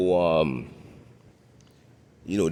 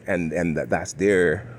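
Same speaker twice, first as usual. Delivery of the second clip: ordinary delivery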